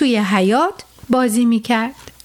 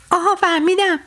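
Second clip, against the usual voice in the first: higher delighted discovery; aha; 6 semitones higher than usual. Acted. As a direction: realise it suddenly. Sleep discovers the truck. (کشف ناگهانی با صدای زیر)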